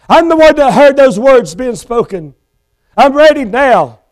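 high, frantic begging; condemned man refusing the verdict; desperate pleading. Acted. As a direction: beg as the door closes. Felix begging, too late.

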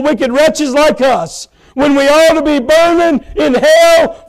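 swelling pulpit preaching; full-force preaching. Acted. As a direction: build it like a revival climax. Preaching climax on God's grace.

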